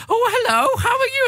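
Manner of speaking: put-on very high sing-song child-greeting voice; talking to a child. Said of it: "and I go".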